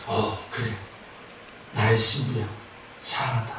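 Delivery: a hushed, low loving whisper to his bride; tender love. Acted. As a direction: speak softly as a loving bridegroom. Jesus speaking lovingly to bride.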